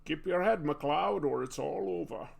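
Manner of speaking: put-on Scottish accent passed off as Egyptian; Scottish-voiced ancient immortal giving counsel; Sean Connery. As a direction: put on a mock Scottish burr. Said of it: "That's my Egyptian accent. Ramirez".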